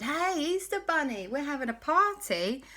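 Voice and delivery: high, bright invitation to a party; party friends calling from a submarine; excited friends. Acted. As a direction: call out gleefully to the bunny. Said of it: "Quasi Cat and Dashy said".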